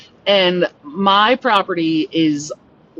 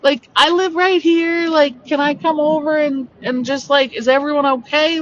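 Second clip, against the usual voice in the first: high, raised voice calling across to strangers; calling over; 9 semitones higher than usual. Retold or imitated. Calling across the road to the crashed car, high and raised. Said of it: "And so I shout over".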